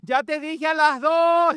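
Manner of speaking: high, drawn-out yell, mocking angry parents. Preacher imitates parents counting at their child, mock yell. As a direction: stretch the count into a shrieked warning. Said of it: "le grita así".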